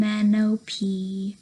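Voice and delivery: normal talking voice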